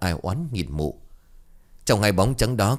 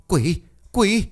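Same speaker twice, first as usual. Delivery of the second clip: very high, loud terrified scream; 11 semitones higher, 4 dB louder than usual. Acted. Woman in the woodshed screams at the ghosts, terrified. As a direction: shriek it in sheer terror. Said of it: "kinh hãi hét toáng cả lên".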